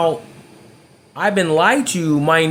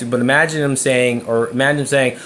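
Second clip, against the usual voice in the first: ordinary talk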